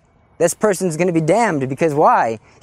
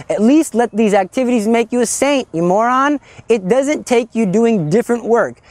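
higher, urgent scolding sermon; fiery preaching; 4 semitones higher than usual. Real, not acted. Preacher scolding his listeners into holiness, fired up. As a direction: hammer it home to the congregation. Preaching builds to full force.

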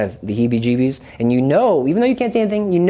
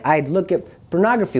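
plain reading voice

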